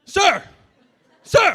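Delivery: shouted, rising each time; calling out. Retold or imitated. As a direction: clerk yelling to get his attention.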